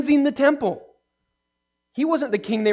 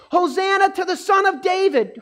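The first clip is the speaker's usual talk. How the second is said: crowd cheering; 9 semitones higher than usual. Retold or imitated. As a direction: call it out like a crowd welcoming a king. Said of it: "read the things that the crowd was saying".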